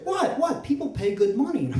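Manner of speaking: high, indignant mock protest; defensive father. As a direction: protest innocence as the clueless dad. Retells father's defensive reply.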